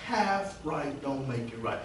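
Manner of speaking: quiet, slow echo of the refrain; soft repeat. Shown as a demonstration. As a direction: drop to a hush for half right don't make it right. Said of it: "repeated softly".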